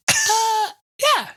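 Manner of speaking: very high, flustered yes; high, loud, panicky enthusiasm; mock eager agreement. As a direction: squeak out an over-keen acceptance. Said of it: "And I was like".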